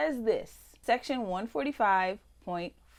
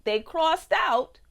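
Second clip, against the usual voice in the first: heated outrage; 3 semitones higher, 6 dB louder than usual. Real, not acted. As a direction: build to an indignant peak. Climactic outraged reading.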